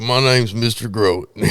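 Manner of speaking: put-on slow, twangy cowboy accent. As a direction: play a slow Texan cowboy. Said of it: "Nice cowboy voice".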